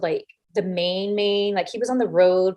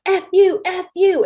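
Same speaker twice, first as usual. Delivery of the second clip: high, loud mock cursing; imitated angry outburst; 10 semitones higher, 5 dB louder than usual. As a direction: fire it off like a furious wrestler. Wrestler swears in her face.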